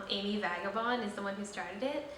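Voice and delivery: ordinary delivery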